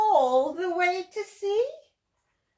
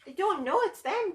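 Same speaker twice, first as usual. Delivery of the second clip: ordinary delivery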